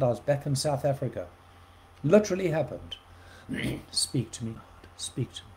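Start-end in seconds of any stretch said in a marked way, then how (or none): 3.9-5.5 soft, almost whispered plea to God; hushed prayer. Retold or imitated. Preacher retelling his prayer, hushed plea. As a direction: quietly ask God to speak.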